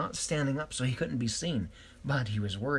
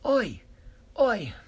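Quasi shouting high and loud; high calling out; 10 semitones higher, 6 dB louder than usual. A narrator's reading. Quasi climbing from the well calling friends, loud high shout.

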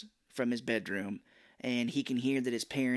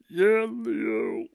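louder, higher cartoon turtle; Ninja Turtle voice; 5 semitones higher, 6 dB louder than usual. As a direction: shout it like a Turtle. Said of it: "I was doing all the voices".

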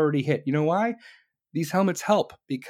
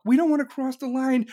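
ordinary talk, nothing marked

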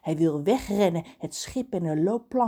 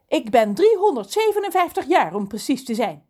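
offended; 6 semitones higher, 6 dB louder than usual. A narrator's reading. Lorelei corrects Reus about her age, offended. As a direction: correct them with wounded pride. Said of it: "zegt Lorelei beledigd".

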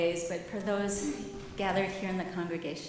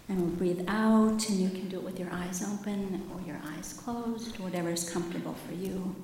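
ordinary talk, nothing marked

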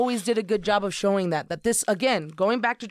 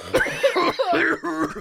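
sputtering cough through the nose; spit take; 6 semitones higher than usual. Real, not acted. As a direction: choke and sputter on a drink. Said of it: "It came out my nose".

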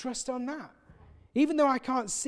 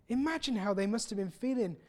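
usual speaking voice

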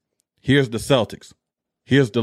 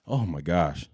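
low, softer, weary groan of words; weary sigh; 7 semitones lower, 8 dB quieter than usual. Real, not acted. To listeners about repeat debates, low and weary. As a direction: let it sink, worn out.

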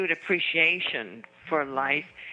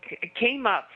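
usual speaking voice

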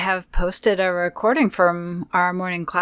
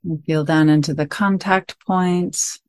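guided meditation; 7 dB louder than usual. Acted. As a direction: guide listeners gently into stillness, phrase by phrase.

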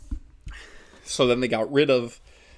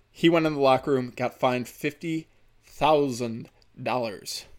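ordinary delivery

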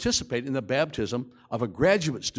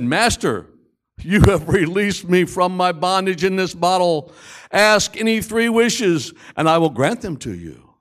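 genie; 4 semitones higher, 6 dB louder than usual. Acted. Genie speaks from the bottle.

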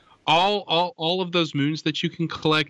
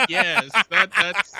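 laughing through the words; 9 semitones higher, 4 dB louder, slower than usual. Real, not acted. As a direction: burst out laughing while answering. Laughing at the mushrooms joke.

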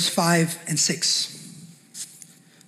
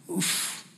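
breathy oof; 11 dB quieter than usual. Real, not acted. Reacting to the people's distance.

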